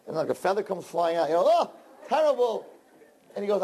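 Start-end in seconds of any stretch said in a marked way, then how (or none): 1.5-2.7 high, revolted wife voice; wife spitting out the bird in a high, revolted voice; disgusted wife. Acted. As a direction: recoil from the taste.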